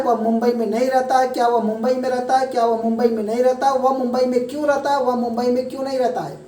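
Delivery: rattled off fast, one sentence after another; fast drill. Shown as a demonstration. Teacher showing students how fast to run the Hindi drill, quick. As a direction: race through the six forms like a tongue twister. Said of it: "और तेज साथियों, और तेज".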